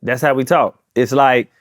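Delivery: ordinary delivery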